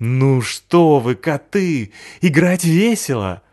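high, slow, pleading; coaxing dog. Acted. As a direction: persuade the cats. Пёс уговаривает котов. (уговаривающий щенок)

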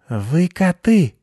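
high, slow squeaky bat cry; high-pitched bat, startled; squeaky bat shock. A narrator's reading. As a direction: gasp it out as a startled little bat. Head bat spots the cats.

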